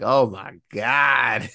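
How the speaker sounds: slow, drawn-out doomed groan; dismayed train. Retold or imitated. The train seeing the bridge go, slow doomed dismay. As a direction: voice the train as it realises it's falling. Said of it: "that train's like".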